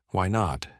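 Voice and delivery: ordinary delivery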